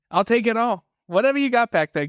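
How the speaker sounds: high, mock-greedy demand; playful demand. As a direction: play at wanting all of it. Listener playfully voices the eager demand.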